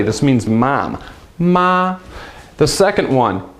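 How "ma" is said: MA held high and steady; one high, level note on MA; first tone. Shown as a demonstration. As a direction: say MA without any rise or fall. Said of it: "your voice just stays steady".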